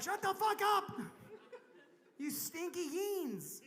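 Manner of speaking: high-pitched mock complaint, drawn out at the end; hammed-up telling-off; mock angry yell. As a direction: lightly play a sleepless neighbour yelling. Mimics sleepers yelling at partiers.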